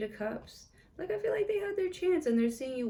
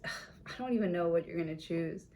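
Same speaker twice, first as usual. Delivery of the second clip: ordinary talk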